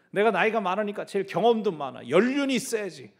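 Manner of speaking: put-on an older disciple showing off; mock boasting elder. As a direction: brag about seniority.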